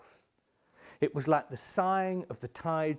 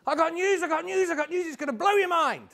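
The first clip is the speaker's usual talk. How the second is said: high, loud, breathless-with-news rush; young girl blurting big news, very high; excited girl; 13 semitones higher, 4 dB louder, faster than usual. Acted. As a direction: burst in bubbling over with news.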